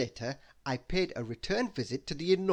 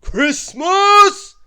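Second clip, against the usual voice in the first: huge loud shout, much higher; booming giant; 19 dB louder than usual. Acted. Giant wizard greeting his small visitor with a booming shout. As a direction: bellow the name with giant delight. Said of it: "he boomed in a voice that made my head spin".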